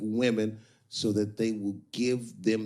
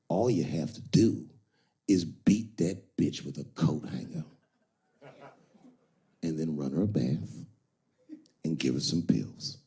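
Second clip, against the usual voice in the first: put-on lower, hushed, conspiratorial old pimp, sinking to a whisper; old pimp advice; 6 semitones lower than usual. Older pimp coaching Iceberg Slim, low and hushed. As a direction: lean in low and confide like a cold old pimp. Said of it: "the older pimp says".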